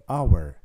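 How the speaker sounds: "hour" said with no h, starting on the vowel; silent h. Shown as a demonstration. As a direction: drop the h, open on the vowel of hour. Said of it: "le premier son que j'entends, c'est A".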